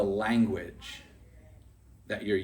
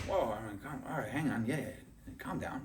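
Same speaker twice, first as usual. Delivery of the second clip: softer, soothing a hothead; calming; 8 dB quieter than usual. Retold or imitated. Tony Robbins calming his furious partner, softly soothing. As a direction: settle him down gently.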